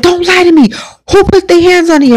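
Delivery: high, pressing grandma voice demanding the truth; stern grandmother. Retold or imitated. Grandmother demands to know who hit the child. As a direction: voice the grandmother who won't be lied to.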